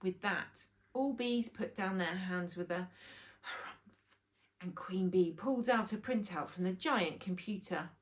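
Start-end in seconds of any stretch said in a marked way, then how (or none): none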